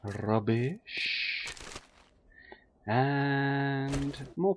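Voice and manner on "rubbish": ordinary talk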